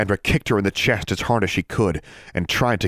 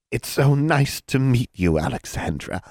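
hag voice; 7 semitones higher than usual. Acted.

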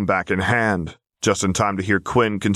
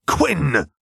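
sharp, higher-pitched bark; barked name; 8 semitones higher than usual. A narrator's reading. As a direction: bark it into the comm. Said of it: "Zev barked into his comm".

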